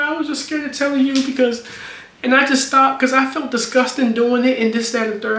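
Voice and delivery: high whiny imitation of her excuse; mock girlfriend voice.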